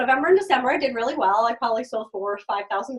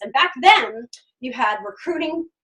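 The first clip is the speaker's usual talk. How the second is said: normal talking voice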